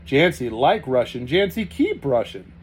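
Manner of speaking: Jancy like Russian, Jancy keep Russian announced loudly; loud joyful announcement. A narrator's reading. Sergei announcing the news to the family, loud and beaming. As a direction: proclaim it to everyone, beaming. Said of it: "he announced".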